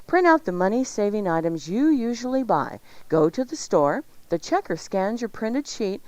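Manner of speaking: ordinary delivery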